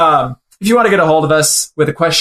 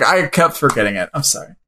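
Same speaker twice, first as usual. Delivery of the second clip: ordinary delivery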